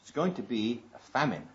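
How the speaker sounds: ordinary talk, nothing marked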